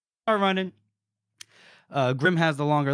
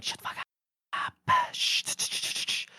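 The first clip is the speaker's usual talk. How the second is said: urgent whisper; 7 dB quieter than usual. Retold or imitated. Grim hushing near guards.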